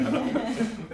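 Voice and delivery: high laugh; real laugh. Real, not acted. Speaker with the audience, high laugh.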